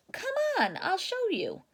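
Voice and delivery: very high, eager; hammed-up cheerful Papa Bear. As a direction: beckon like a jolly bear dad. Papa Bear leading family out.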